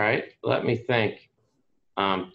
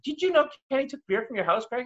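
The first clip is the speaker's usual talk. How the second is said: tattling friend; 13 semitones higher than usual. Retold or imitated. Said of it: "Ivanka's like".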